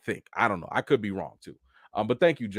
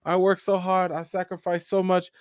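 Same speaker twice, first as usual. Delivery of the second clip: higher-pitched mock martyr voice; hammed-up self-pitying parent; guilt-tripping parent, imitated; 7 semitones higher than usual. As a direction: lay on the guilt like a long-suffering parent. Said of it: "hit you with them guilt trips like".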